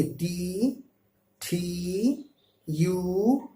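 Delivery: very slow टी with breathy T; aspirated T. Shown as a demonstration. Teacher modelling the breathy English T for students. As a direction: stretch टी out with air on the T. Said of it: "आपको इसी तरह बोलना है".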